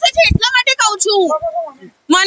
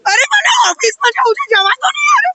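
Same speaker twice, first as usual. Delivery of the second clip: high, exasperated shout to be let go; frustrated shout; 13 semitones higher than usual. Real, not acted. Woman shouting at police to let her go. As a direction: cry out to be released.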